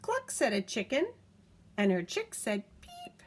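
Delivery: lively picture-book reading; reading to a child. A narrator's reading. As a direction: read it aloud to a toddler, bouncy and clear. Reads picture book aloud.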